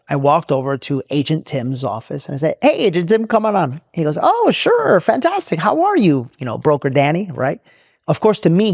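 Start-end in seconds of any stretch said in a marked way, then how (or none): 2.6-3.8 high-pitched, re-enacted friendly call; cheery greeting. Boss hails agent, feigning friendliness. 4.2-6.3 hammed-up delight, much higher pitch; happy, nice greeting. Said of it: "he's gonna be happy".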